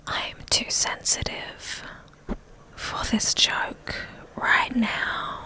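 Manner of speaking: gasp, then a slow breathy whisper; hushed, wounded gasp and whisper; mock-hurt whisper, slowed right down; offended whisper. Mock offended reaction to joke.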